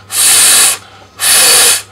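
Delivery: "f" as a long unvoiced stream of air; f sound. Shown as a demonstration. Teacher modelling letter F, "f" long and unvoiced. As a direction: hold "f" as pure breath, fff. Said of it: "which makes the f".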